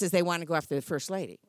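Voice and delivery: ordinary delivery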